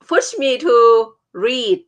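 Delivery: ordinary talk, nothing marked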